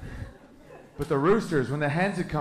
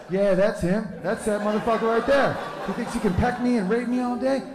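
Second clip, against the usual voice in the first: high, indignant hen voices; angry hens; 9 semitones higher than usual. Retold or imitated. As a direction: accuse the rooster like wronged hens. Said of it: "they're like".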